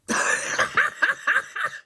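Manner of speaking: squeaky high burst of laughter. Real, not acted. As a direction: break into a high giggle. Laugh after whisper.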